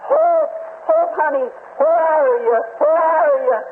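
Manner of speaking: high, anguished calling out, not full volume; retold desperate scream. Husband searching the refugee shelter for his wife, imitated high desperate cry. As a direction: call out high, re-enacting a frantic search. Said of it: "screaming top of my voice".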